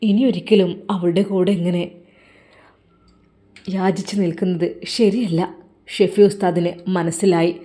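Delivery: ordinary delivery, nothing marked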